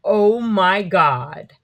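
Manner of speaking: slow, drawn-out astonished oh my god; amazed. Retold or imitated. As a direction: drag it out in awe. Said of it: "he was like".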